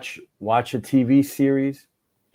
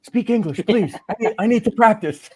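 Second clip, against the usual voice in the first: high, imploring imitation; hammed-up eager plea; pleading stranger; 11 semitones higher, 3 dB louder than usual. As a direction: beg for practice, voice pitched way up. Imitating strangers begging for English practice.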